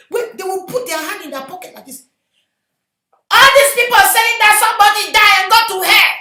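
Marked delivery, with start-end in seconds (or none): 3.3-6.1 loud, high-pitched sermon cry; impassioned preacher shouting; full-force preaching. Real, not acted. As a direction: thunder it out to the congregation.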